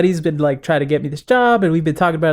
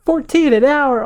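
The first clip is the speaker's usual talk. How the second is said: shocked disbelief; 10 semitones higher than usual. Retold or imitated. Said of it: "I was like".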